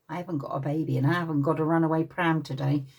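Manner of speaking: usual speaking voice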